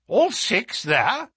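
high, slow, astonished echo; agitated question. Acted. Colonel hears all six horses are running, agitated disbelief. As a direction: query it in agitation, rising at the end. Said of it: "cried the Colonel in great agitation".

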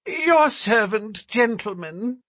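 high, thin nervous little man. A narrator's reading. Narrator voices Thaddeus.

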